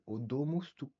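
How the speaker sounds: usual speaking voice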